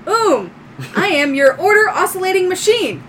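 high, loud robot voice; little droid. Acted. Little droid introducing itself to owner, high robotic announcement. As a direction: announce your function like a small robot. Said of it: "your little light droid".

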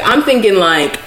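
ordinary talk

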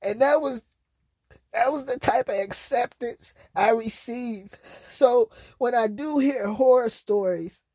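high, tearful, voice catching; near tears. Real, not acted. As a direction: speak through held-back tears.

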